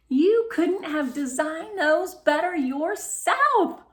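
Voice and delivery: high, slow delighted compliment; pleased praise. Acted. Mom praising Melody's card choices, slow and delighted. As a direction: savour the compliment.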